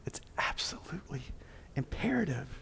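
unvoiced intense whisper. Real, not acted. Preacher to congregation, urgent whisper. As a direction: lean in and whisper it.

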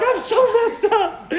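very high, slow comic squeal; mock distressed wail. Preacher playing himself ashamed before God, mock wailing squeal. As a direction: wail it in hammed-up shame.

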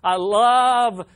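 loud, mock-gushing emphasis on love; sarcastic. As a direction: gush with obvious sarcasm. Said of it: "I'm being sarcastic here".

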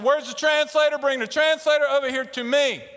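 high, full-volume demand; retold angry yelling. Fed-up mission leader summoning the translator, reenacted angry yell. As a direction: holler for help like a fed-up boss. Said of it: "I'm talking just like this".

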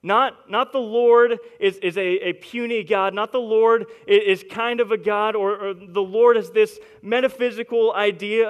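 loud, driving preacher's voice; full-force preaching. Acted. Pastor preaching to his congregation, loud and driving.

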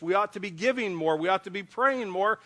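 ordinary talk

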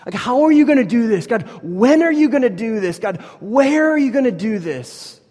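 high, loud frantic questions to God; anxious pleading. Retold or imitated. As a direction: plead like a panicked believer. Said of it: "God, how?".

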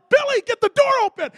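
high-pitched hollered order; urgent calling out. Retold or imitated. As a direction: imitate a frantic dad calling his kid. Father yelling to his kid.

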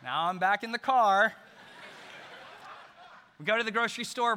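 loud, high, laughing through the words. Real, not acted. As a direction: laugh it out with the crowd. Laughing at his own reaction.

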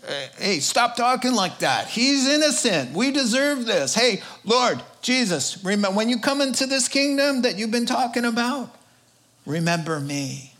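high, loud urgent cry to Jesus; urgent pleading call. Acted. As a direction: call out desperately from the cross. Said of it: "all he said was".

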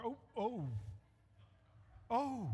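usual speaking voice

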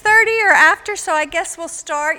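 loud, projecting to the room; teacher voice. Real, not acted. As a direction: project to the back without a mic. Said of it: "get my teacher voice tonight".

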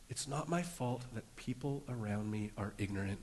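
soft, quiet self-justifying excuse; understated excuse. Acted. Preacher voicing someone's critical spirit, quietly self-justifying. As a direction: softly shrug off the blame.

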